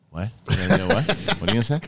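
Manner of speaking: mean-mugging what-are-you-saying; mock tough New Yorker. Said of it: "mean mugging each other".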